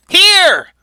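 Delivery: high, loud theatrical cry; acted one-word shout; calling out. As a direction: call it out sharply across a battlefield. Douglas calls out the body.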